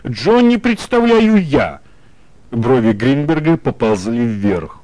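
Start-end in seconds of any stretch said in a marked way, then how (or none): 0.1-1.8 exclaiming. A narrator's reading. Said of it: "вскричала вдруг Бетси".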